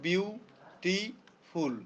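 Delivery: "Beautiful" slowly split as বিউ, টি, ফুল; drawn-out "beautiful" with two clear pauses; three syllables. Shown as a demonstration. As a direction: break "beautiful" into three spaced chunks. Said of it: "তিনটা".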